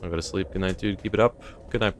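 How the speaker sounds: ordinary delivery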